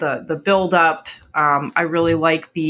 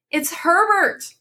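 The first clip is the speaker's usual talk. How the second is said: high, slow, drawn-out cry of hope; desperate hope; 13 semitones higher, slower than usual. A narrator's reading. Mother hearing a knock and hoping for her dead son, slow high cry. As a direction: cry out the son's name in wild hope.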